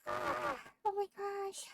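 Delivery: talking through a yawn; stretched yawning sigh. Real, not acted. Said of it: "Keep yawning at you".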